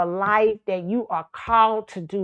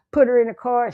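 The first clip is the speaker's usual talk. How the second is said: ordinary delivery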